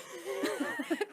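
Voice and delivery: bright high laugh. Real, not acted. Laugh after intro.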